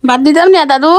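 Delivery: calling out loudly to grandpa; to someone hard of hearing. Real, not acted. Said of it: "এই জন্য চিল্লাই চিল্লাই কথা বলা লাগে".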